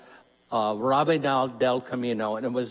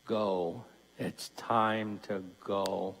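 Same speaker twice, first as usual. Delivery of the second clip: God's answer, voiced; 5 semitones lower, 5 dB quieter, slower than usual. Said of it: "the idea he'll say".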